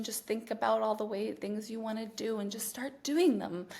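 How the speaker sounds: softly voiced positive-thinking coach, parodied; mock gentle coaching. Parodying the industry's positive-thinking advice, mock gentle.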